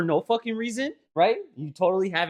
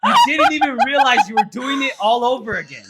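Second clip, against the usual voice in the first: exasperated yell; 9 semitones higher, 9 dB louder than usual. Real, not acted. Brother yelling, exasperated.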